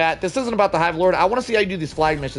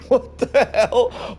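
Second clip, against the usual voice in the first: laughing disbelief; 6 semitones higher, much slower than usual. Real, not acted. Laughing at long name.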